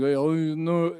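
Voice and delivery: lightly mocked slurring action star; a quick, hammed-up movie tough guy; Stallone. Teasing about a hacky impression, put-on actor voice. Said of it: "someone hacky like Stallone".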